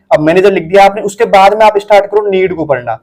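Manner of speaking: ordinary delivery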